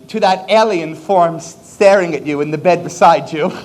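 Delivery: normal talking voice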